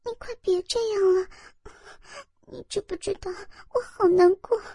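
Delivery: high, tearful, begging lover; whimpering girlish plea, soft; pleading young woman. Acted. Narrator voices the woman pleading. (带哭腔的娇声哀求)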